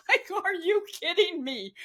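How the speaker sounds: retold incredulous laugh, pitched high; high, amused mock incredulity; laughing disbelief. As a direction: laugh it off as you act out your disbelief. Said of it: "I just laughed in their face".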